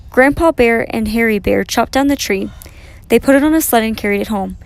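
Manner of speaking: ordinary delivery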